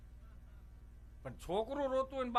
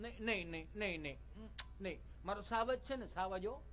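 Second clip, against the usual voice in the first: soft, hushed soothing; cooing mother; 2 semitones lower, 7 dB quieter than usual. Retold or imitated. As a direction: comfort the fallen child tenderly. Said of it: "માનું મમત્વ". (મમતાભર્યો ધીમો દિલાસો)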